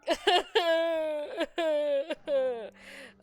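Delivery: high, loud laugh edging into a cry; loud laughing fit. Real, not acted. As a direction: crack up helplessly. Laughs at own accent.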